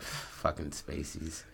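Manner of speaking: low, slow, quiet mutter. Retold or imitated. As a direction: grumble it under your breath. Character's bitter last line.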